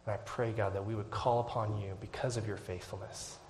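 ordinary delivery

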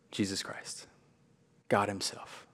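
soft, breathy-low naming of Christ; almost a whisper, in awe; hushed and reverent; hushed reverence. Acted. Reverent emphasis on the focus.

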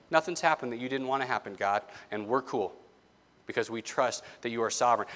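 quiet, relaxed trust, a bit fast; calm. Retold or imitated. Apostles telling God, calm and unworried. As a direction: say it like nothing can shake you. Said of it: "could so calmly say".

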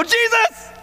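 high, panicked call of the name; panicked cry. Retold or imitated. Frightened disciples crying out to Jesus in the storm, panicked. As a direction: yell for him in terror over the storm.

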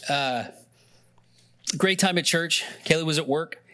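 ordinary delivery

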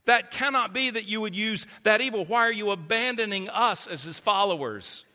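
high, urgent questioning of God; lamenting plea. Retold or imitated. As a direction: cry out why, pitch lifted. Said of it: "Surely that must have been the sentiment".

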